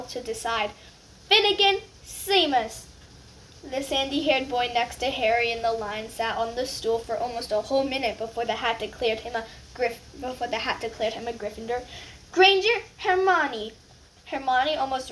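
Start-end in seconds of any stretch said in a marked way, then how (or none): 1.3-2.8 higher, louder name call. Acted. Names called out. 12.3-13.7 higher, louder, slow name call. Acted. Names called out.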